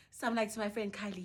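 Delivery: ordinary talk, nothing marked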